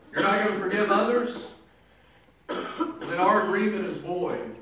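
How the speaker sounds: the betrayed king cancelling the deal in anger; an outraged master confronting his unforgiving slave; angry king. Acted. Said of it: "moved with anger".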